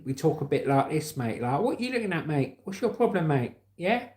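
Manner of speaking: cocky southerner accent, pitch jumping up on questions; southern English accent, picking a fight. Shown as a demonstration. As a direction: put on a confrontational southern English lad. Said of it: "And then you've got the southerners".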